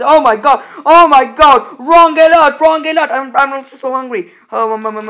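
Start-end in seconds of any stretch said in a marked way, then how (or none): 0.0-3.1 loud, higher, panicked alarm; yelling a warning about mobs; alarmed shouting. Real, not acted. Gamer to viewers, loud panicked alarm. Sudden mob alarm in game.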